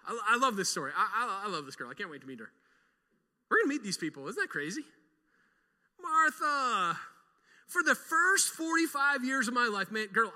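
usual speaking voice

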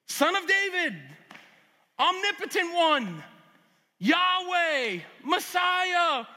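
high, loud crescendo of divine titles; preaching at full force. Acted. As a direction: preach it, piling up the names.

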